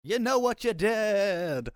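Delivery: high, slow, drawn-out ghost moan; ghostly wail. Acted. Player spooking the men as ghost: long eerie wail. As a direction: stretch it out like a haunting ghost.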